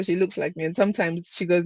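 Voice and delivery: ordinary talk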